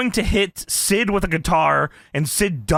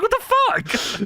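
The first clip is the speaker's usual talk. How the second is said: high, slow mock outrage; much slower than usual. Cohost mimics angry Steiner, high and drawn out. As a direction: stretch it out, high and baffled. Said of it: "He's like".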